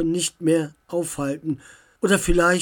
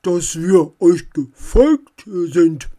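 slow, loud goblin voice; fat goblin; 7 dB louder, slower than usual. A narrator's reading. Fat goblin turning up behind the group, slow creature voice. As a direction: play a big slow goblin, loudly. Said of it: "sagte der dicke Kobold".

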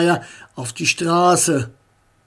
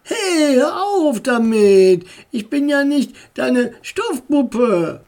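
indignant protest; 7 semitones higher than usual. A narrator's reading. Little brother protesting as his sister ruffles his hair, indignant. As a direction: push her hand away with your voice.

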